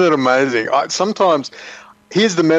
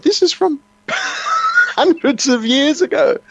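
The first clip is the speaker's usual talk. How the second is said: high, slow laughing outburst; real incredulous laugh breaking through the words; laughing shout; 13 semitones higher, slower than usual. Real, not acted. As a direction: laugh it out in disbelief. Incredulous about centuries-old map.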